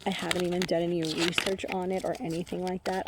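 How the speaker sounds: normal talking voice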